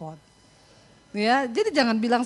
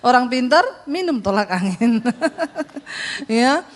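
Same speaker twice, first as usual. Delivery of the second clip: ordinary delivery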